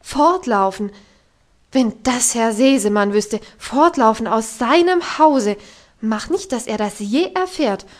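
loud, worked-up tirade ending in a yelled Sag; agitated scolding. Acted. Governess berating Heidi for running away, loud agitated scolding. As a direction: rant at the ungrateful child. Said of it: "schlug die Hände zusammen vor Aufregung".

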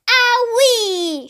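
high, drawn-out squawky cry; storyteller's cartoon hen shriek. A narrator's reading. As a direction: let out a startled hen's yelp. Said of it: "shrieked the hen".

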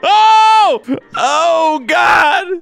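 high, loud, stretched cries; shocked yell. Real, not acted. Gamer reacts to mishap in flight, shocked shouting. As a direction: cry out in sudden alarm. Something crashes in the game.

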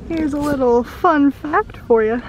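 presenter voice. Acted. Fun fact presenter bit.

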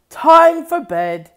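sleepy yawning voice. Acted. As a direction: yawn through the words.

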